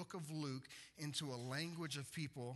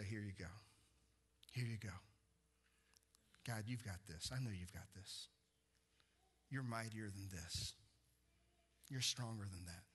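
lower, softer prayer of release; hushed trusting prayer; quiet surrender; 5 semitones lower, 3 dB quieter than usual. Acted. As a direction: hand it over gently to God.

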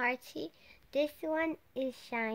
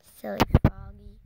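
usual speaking voice

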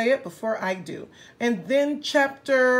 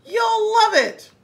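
high, louder playful promise; gleeful high encouragement; 9 semitones higher, 6 dB louder than usual. Acted. Hippo cheerfully teasing rabbit on the swing, high and bright. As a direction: cheer a nervous friend on.

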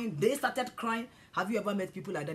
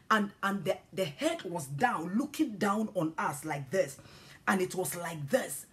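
usual speaking voice

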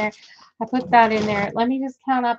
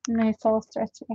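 ordinary talk

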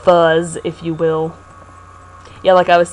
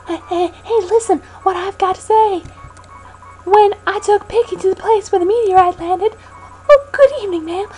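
Pokey voice; 9 semitones higher than usual. Acted. Voicing Pokey character.

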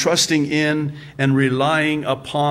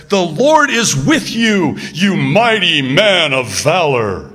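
angel's proclamation; 4 semitones higher, 5 dB louder, slower than usual. Retold or imitated. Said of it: "the angel of the Lord appears to him and says".

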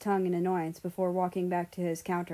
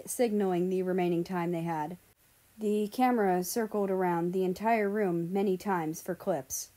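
ordinary delivery, nothing marked

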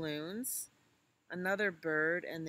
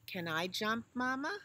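put-on high child voice; 6 semitones higher, 3 dB louder than usual. Little elephant asks mama.

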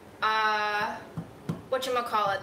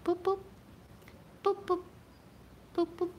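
high playful boops; 6 semitones higher, 6 dB quieter, faster than usual. Real, not acted. Booping pet's belly.

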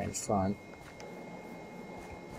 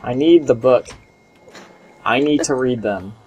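loud demand; 3 semitones higher, 5 dB louder than usual. Real, not acted. Demanding the book loudly.

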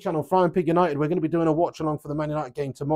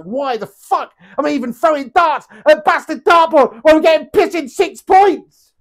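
frustrated outburst; 9 semitones higher, 10 dB louder than usual. Retold or imitated. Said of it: "You're like".

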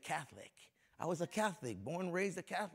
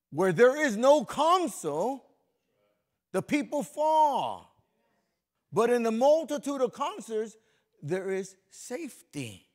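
high, loud, slow proclaiming of scripture; scripture reading; 6 semitones higher, 10 dB louder, slower than usual. Acted. Pastor reading Proverbs aloud to the congregation, slow and booming. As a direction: declaim each phrase like a herald.

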